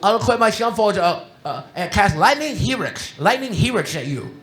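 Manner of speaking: lisping imitation of a young Magic player; imitating a speech impediment. Quoting Casey's Magic taunt, imitating his speech impediment. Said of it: "he had a speech impediment".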